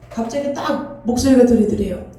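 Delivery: ordinary talk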